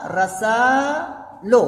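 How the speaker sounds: रसा लो drawn out very slowly in two parts; रसा लो stretched into a slow model reading; slow demo reading. Shown as a demonstration. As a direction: sound out रसा लो slowly for the class. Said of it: "इस तरह से बनेगा".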